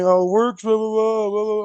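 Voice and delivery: mock complaint babble, pitched a bit up; mocking moaning. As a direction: parody a friend's endless griping. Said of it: "bitch and moan about".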